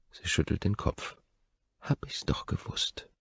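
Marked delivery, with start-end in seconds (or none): 1.8-3.1 whispered. Acted. Knowing aside to himself.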